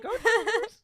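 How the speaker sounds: very high, loud imitation cheer; mock team cheer. Co-host mimicking the cheer just made, high loud mock cheer. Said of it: "That was like".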